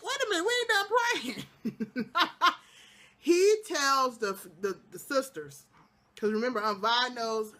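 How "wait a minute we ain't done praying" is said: high, laughing mock objection; reacting to the prayer, laughing high; laughing protest. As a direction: cut in laughing. Speaker reacting mid-prayer, laughing.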